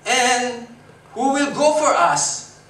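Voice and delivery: high, loud and slow, like God calling; booming call. Acted. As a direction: call out slowly across the room. (malakas at mabagal na tawag ng Diyos)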